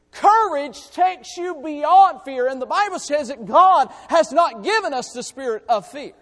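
loud, high, rising preacher's cry; preaching at full force. Acted. Preacher urging the church to courage, full force. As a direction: drive the point home to the congregation.

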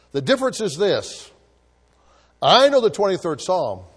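loud, high earnest declaration; actor's admission. Retold or imitated. Actor speaks to the guests, loud and earnest. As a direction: proclaim it to the whole room. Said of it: "and said".

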